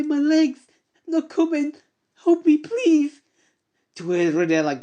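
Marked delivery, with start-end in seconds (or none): 0.0-3.2 very high, loud frantic cries; terrified screaming. Acted. Hero screams as the dead approach, high terrified cries. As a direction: scream for help as they close in. Hero panics as undead come.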